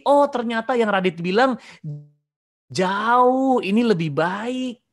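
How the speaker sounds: high, loud amazed realisation; delighted discovery. Acted. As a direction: exclaim it, stretching the key words. Acts out a delighted first-hand discovery.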